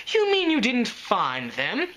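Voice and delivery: high, slow, shocked lady; surprised Rarity voice. Acted. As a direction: gasp it out in dismay.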